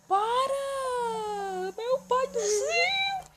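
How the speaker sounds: high piercing scream of alarm; screaming in panic. Real, not acted. Kid gaming, panicked shriek at the screen. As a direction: shriek it as everything goes wrong.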